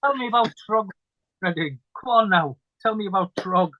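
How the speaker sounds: a casual, lightly put-on Irish brogue, pleading; Irish accent. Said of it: "I just gave you an Irish accent".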